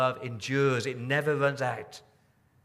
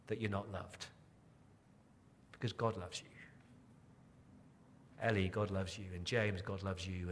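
soft personal assurance; 5 semitones lower, 11 dB quieter than usual. Real, not acted. Personal assurance to named listeners.

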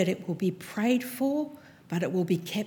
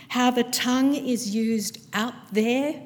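ordinary talk

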